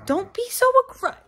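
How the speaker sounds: high-pitched plea to stop; protective protest. Real, not acted. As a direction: protest in a high voice on a pet's behalf. Protesting on the cat's behalf.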